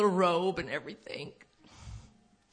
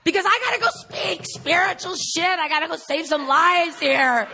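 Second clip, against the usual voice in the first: much higher, much louder sarcastic boast; mock grandiose; 9 semitones higher, 9 dB louder than usual. Mocking her role as a spiritual speaker, sarcastic loud boast. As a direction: puff yourself up and mock it.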